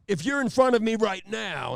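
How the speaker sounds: loud, high, menacing swagger; Jack Nicholson. Retold or imitated. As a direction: declaim it at the whole room, with theatrical pauses. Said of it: "like he's Jack Nicholson".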